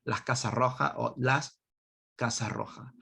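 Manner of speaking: "Casa Roja" in a Spanish accent; Spanish-accented pronunciation. Shown as a demonstration. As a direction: redo "Casa Roja" with Spanish sounds instead of English. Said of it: "I don't know why I said that with an English accent".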